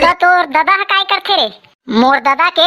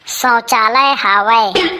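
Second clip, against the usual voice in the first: slow dictation; 8 semitones lower, much slower than usual. Acted. Repeats answer while writing.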